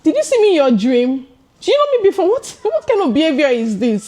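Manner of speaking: high-pitched acted outrage; retold indignant telling-off; indignant comeback. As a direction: challenge a rude assistant, pitch jumping up. Retold quarrel with rude assistant.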